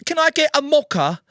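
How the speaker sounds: put-on Italian accent mocha order. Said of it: "He turned Italian".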